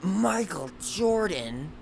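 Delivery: high, slow, booming name reveal; dramatic announcer. Retold or imitated. Movie hyping its star's entrance, mock dramatic announcer. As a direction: draw the name out like an arena announcer. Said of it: "and it's like".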